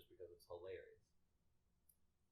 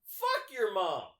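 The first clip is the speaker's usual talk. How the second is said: slow, high, loud mock heckle; imitated yelled retort; 11 semitones higher, 14 dB louder, much slower than usual. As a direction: yell it out like the mouthy coworker. Coworker's yelled retort, acted.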